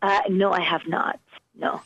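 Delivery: usual speaking voice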